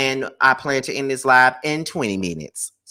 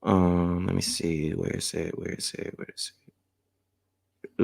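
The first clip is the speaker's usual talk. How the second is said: very soft, low talking to oneself; absent-minded muttering; 5 semitones lower, 8 dB quieter than usual. Real, not acted. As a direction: trail into a near-whisper while hunting for something. Talking to herself while setting alarm.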